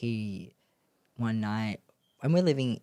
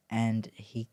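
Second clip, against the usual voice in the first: ordinary talk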